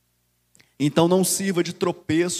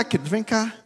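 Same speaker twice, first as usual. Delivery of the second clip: ordinary talk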